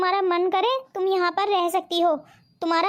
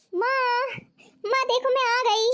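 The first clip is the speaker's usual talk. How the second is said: slow, high call to mother; 4 semitones higher, slower than usual. Acted. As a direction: call out to mom on arriving. Daughter arriving home calling mother. (ऊँची खिंची पुकार)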